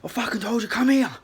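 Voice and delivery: high, exasperated mock yell. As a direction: imitate an angry guard calling someone back. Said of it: "he's yelling at me".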